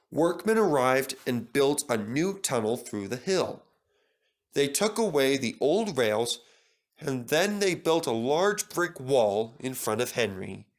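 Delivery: usual speaking voice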